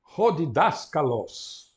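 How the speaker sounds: ho diDASkalos drawn out slowly; slow model word. Shown as a demonstration. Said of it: "Outra palavra que conhecem muitíssimo bem".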